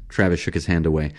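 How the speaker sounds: plain reading voice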